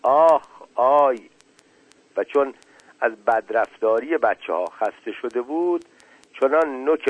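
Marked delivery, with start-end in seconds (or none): 0.0-1.3 drawn-out, louder "ow, ow"; slow acted yelps of pain; cry of pain. A narrator's reading. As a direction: cry out as if hurt. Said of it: "چیپی فریاد زد".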